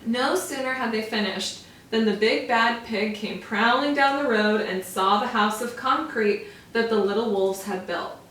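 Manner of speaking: ordinary delivery, nothing marked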